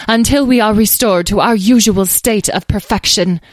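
high, loud, haughty decree; imperious fairy. A narrator's reading. Muddy fairy orders visitors to wait, imperiously. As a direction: pronounce it grandly as a vain fairy. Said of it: "in an imperious tone".

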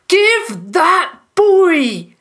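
high, slow, loud shout; loud, drawn-out demand. A narrator's reading. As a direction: shout the order word by word. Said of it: "Mister Brinton shouted".

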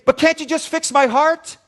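high, loud whine to the doctor; whiny plea. Retold or imitated. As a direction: complain loudly like a lazy patient. Patient whining at cardiologist.

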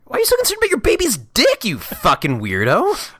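high laughing outburst, slowing on the insult; mock incredulous outrage. Viewer scolding the fussy father, mock incredulous.

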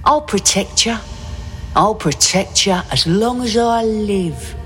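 high, sincere promise; tender promise. Acted. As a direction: vow it tenderly. Father comforts scared child.

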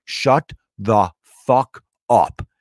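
slow, word by word, with pauses; emphatic retort. Retold or imitated. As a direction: spell out the command one word at a time. Retold telling-off of heckler.

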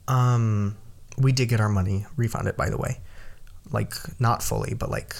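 ordinary talk

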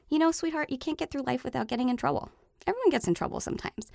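put-on sing-song reassurance for an upset kid; soothing parent voice. Shown as a demonstration. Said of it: "I will often say".

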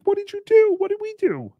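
high falsetto worried relative; shocked family member. Retold or imitated. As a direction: squeak out the family's alarm.

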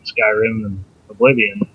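ordinary delivery

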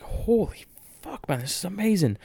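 amazed reaction. Retold or imitated. Retelling his stunned reaction to the kickout, high and amazed. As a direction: replay the moment your jaw dropped.

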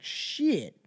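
normal talking voice

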